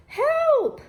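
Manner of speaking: high, loud yelp; mock cry for help. Toot writes from the Alps, a high hammed-up yelp. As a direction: squeal it like you're slipping.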